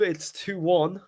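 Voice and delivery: usual speaking voice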